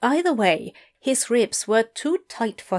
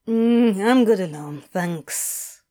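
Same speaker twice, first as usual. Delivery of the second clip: drawl; 2 semitones lower than usual. A narrator's reading. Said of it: "drawled Crowley".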